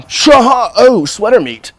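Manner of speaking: high, loud giddy pothead glee; mock stoner finding snacks in his clothes; stoned delight. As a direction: squeal like you found treasure in your sweater. Imitating an excited pothead.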